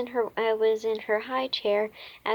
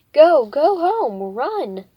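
loud, slow, higher shout; panicked shout; 5 semitones higher, 13 dB louder, slower than usual. A narrator's reading. Missus Trant shouting at the girls to run home. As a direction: shoo them off with a yell. Neighbor panics at burping girl.

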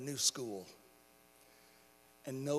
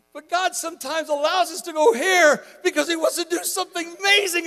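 high, loud, full-force preaching; impassioned preaching; 10 semitones higher, 15 dB louder than usual. Real, not acted. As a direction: preach it out with rising urgency.

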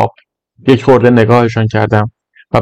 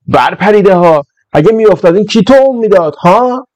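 high-pitched scolding janitor voice; 7 semitones higher than usual. Acted. School janitor catches the boys, high scolding. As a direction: tell off the naughty boys, voice up high.

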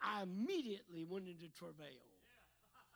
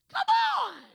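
much higher, loud "come on"; high exclaim; 15 dB louder than usual. Real, not acted. Preacher urges the congregation, high and loud. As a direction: rouse the church.

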